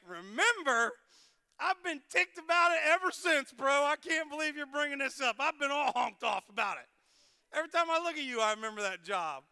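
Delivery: loud, high hammed-up griping at an old rival; mock angry rant. Mock ranting at Will Muff.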